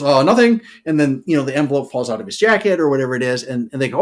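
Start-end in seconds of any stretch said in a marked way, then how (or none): 0.0-0.6 a chirpy, higher and louder fake innocence; mock innocent. Captured courier playing dumb to British soldiers, mock innocent. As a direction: play dumb, brightly. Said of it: "He goes".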